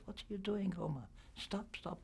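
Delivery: high, softened imitation of a director interrupting; mock dismay. Director halting an actor mid-take, mock dismay.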